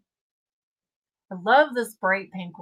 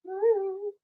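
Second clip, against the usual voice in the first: 1 high woo; 12 semitones higher than usual. Real, not acted. Cheering about custom pins.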